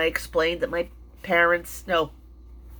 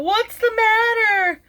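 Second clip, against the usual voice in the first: much higher, slow, calling-out voice of a worried man; alarmed neighbor calling; 6 dB louder, slower than usual. Retold or imitated. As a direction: call out to a stranger in distress, pitched way up. Neighbor runs out calling to her.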